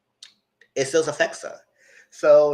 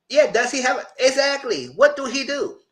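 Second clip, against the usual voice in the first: high incredulous retort; 11 semitones higher than usual. Retold or imitated. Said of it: "And I say".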